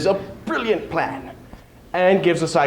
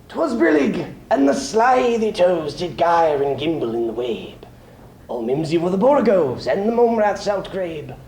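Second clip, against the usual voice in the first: brisk, higher, whimsical nonsense verse; playful poem reading; 3 semitones higher than usual. Acted. Opening Jabberwocky for the audience, playful recitation. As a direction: relish the made-up words.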